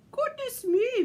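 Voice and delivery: high, loud exclamation of shock; scandalised storybook lady; indignant Missus Claus. A narrator's reading. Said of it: "Missus Claus said".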